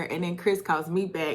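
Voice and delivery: normal talking voice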